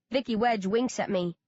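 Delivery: ordinary delivery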